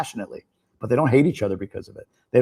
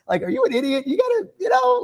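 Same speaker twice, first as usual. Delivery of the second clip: mock exasperation. Mocking a naive young liberal.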